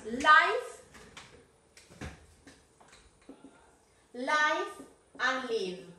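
life and live said slowly, each vowel drawn out; slow contrast. Shown as a demonstration. Teacher drilling Connie on life versus live, slow and careful. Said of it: "ojo entre las palabras".

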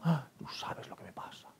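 mock-confidential whisper; whispered victim. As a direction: whisper it like a dramatic secret of suffering. Mimics self-pitying storyteller.

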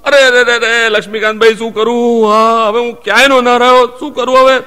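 wailing loudly, hammed-up; mock wailing. Neighbour hams up wailing to the narrator. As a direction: cry out like a man at his wits' end. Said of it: "પોખ મૂકી".

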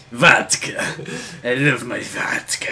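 hammed-up Russian accent. Co-player taking over vodka line, Russian accent. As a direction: savour it in a fake Russian accent. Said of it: "you do the Russian accent".

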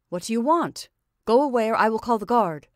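angry threat. A narrator's reading. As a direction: push him away with a loud warning.

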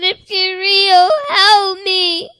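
high, loud, crying plea; tearful plea. Retold or imitated. Child pleading with Jesus in vision, crying loud.

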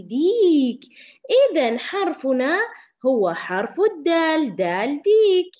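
put-on loud, high, slow, announcing the letter to children; excited teacher reveal. Shown as a demonstration. Teacher loudly announcing the letter to her young pupils. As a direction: boom out the answer for the little ones. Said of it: "صوت ماذا يا صغاري؟".

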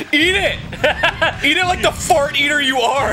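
mock yell. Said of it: "I said".

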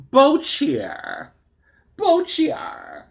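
"Bochier" said high and unsure as BO-chee-er; butchered name. Shown as a demonstration. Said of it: "I'm gonna butcher his last name".

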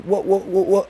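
imitated stutter, stuck on one word. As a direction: act out getting stuck while speaking. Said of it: "I would get stuck on".

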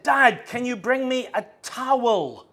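high, loud, slow call; calling for a towel. Retold or imitated. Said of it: "I said".

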